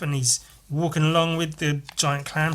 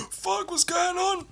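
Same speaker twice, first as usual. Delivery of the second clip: much higher, slow, stunned squeak; shocked shark; 4 dB quieter than usual. Acted. The shark, shocked at the dinosaur inside its mouth, squeaking high and slow. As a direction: sound utterly bewildered, drawing it out high. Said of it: "It's like".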